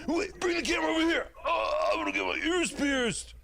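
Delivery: put-on high, fast drunken shout; drunk Don Lemon. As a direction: yell it drunk at the camera. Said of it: "he's probably like".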